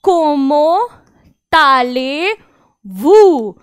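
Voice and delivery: Comment allez-vous split into slow syllables कोमो ताले वू; slow French model; French pronunciation drill. Shown as a demonstration. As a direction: break the phrase into slow parts. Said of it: "pronunciation देख लेते हैं".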